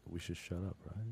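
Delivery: dropped to a hush so as not to disturb; quiet aside, nearly under the breath. Real, not acted. Hushing during energy reading.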